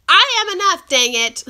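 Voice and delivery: loud, high burst of defiance; defiant self-affirmation. Acted. Talking back to the ugly voices in your head, defiant. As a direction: declare it firmly at your inner critic. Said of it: "to those ugly voices in your head, say".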